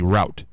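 route rhyming with out; "rout" pronunciation. Shown as a demonstration. Host insisting on his way, route as "rout". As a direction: say route with an ow vowel. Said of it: "It has to be route".

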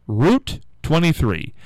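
Route said slowly as "root"; "root" pronunciation. Shown as a demonstration. Host quoting some people, Route said as "root". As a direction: say Route like a plant root, drawn out. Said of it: "some people say route".

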